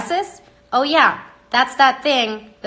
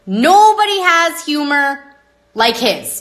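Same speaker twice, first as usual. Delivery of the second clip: emphatic; 6 semitones higher, 5 dB louder, slower than usual. Real, not acted. Praising God's humor emphatically.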